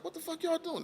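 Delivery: high, shocked cry at the door; startled high yell. Retold or imitated. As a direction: jolt up in pitch, caught off guard. Opening door on feds at dawn.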